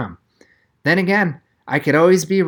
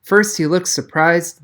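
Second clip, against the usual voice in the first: plain reading voice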